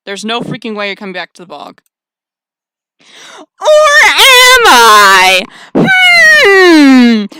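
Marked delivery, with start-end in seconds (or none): 3.6-7.3 huge drawn-out high shout; gleeful bellow. Acted. Player announcing a return to the bog, long bellow. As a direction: stretch each word into a loud, high holler.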